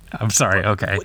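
ordinary talk, nothing marked

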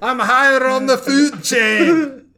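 loud, high hammed-up Scottish accent. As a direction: bellow it in a comic Scottish accent. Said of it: "That's my Scottish accent".

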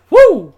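high, loud "woo"; sharp, loud whoop of amazement; amazed whoop. Real, not acted. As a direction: let out a big whoop. Amazed at player's size.

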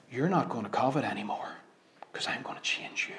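voicing the Spirit, hushed; gentle promise. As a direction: whisper it like a tender promise. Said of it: "It becomes".

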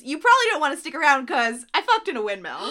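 excitedly re-enacted mic-drop line; emphatic retelling. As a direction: belt out the comeback to prove the point. Insisting she said it to his face.

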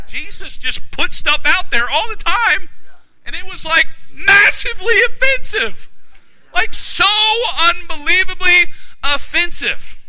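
very high, emphatic preaching; emphatic exclamation. Real, not acted. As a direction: exclaim it with incredulous force. Preacher exclaims over the offense.

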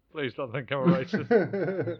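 laughing, drawn-out panicky plea. Retold or imitated. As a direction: crack up while pleading the line again. Anxious inner thought, repeated.

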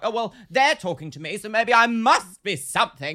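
high, loud, theatrical affront; mock self-importance. Voicing hangers-on who latch onto successful people, loudly self-important. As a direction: puff yourself up, building to exaggerated outrage.

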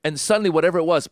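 ordinary talk, nothing marked

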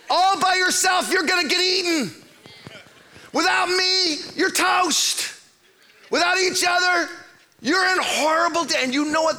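fiery pulpit warning; preaching at full force. Acted. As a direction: drive it home to the congregation.

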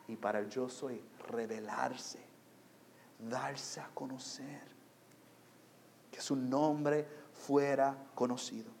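soft, low sermon voice with long pauses; solemn, quiet pulpit delivery; hushed preaching. Real, not acted. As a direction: drop right down and let each phrase settle.

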